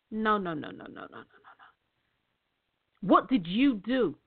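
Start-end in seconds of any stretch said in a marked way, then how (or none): 0.1-1.2 fading no. Shown as a demonstration. Repeated denial fading away.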